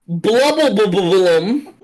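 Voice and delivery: "Blubblebubblum" as one slow, bubbling made-up word; intended pronunciation. Shown as a demonstration. Game master demonstrates the second name, "Blubblebubblum" slowly sounded out. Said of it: "say how he said they are supposed to be pronounced".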